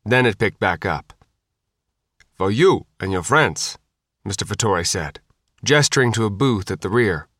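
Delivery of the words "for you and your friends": put-on light Sicilian accent; a touch of a Sicilian host. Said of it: "with a thick Sicilian accent".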